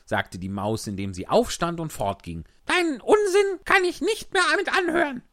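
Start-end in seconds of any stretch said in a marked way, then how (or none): 2.7-5.2 high squeaky mouse, fast and indignant; a mouse storming off; huffy little mouse. A narrator's reading. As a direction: mouse refusing crossly as she leaves.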